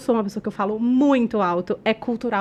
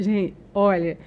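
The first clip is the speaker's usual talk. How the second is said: normal talking voice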